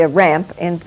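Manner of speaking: ordinary delivery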